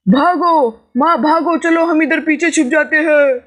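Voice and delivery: bolt in terror, shouting run; panicked cowardly cry. Acted. Son panics at news of the snake and tells mother to run.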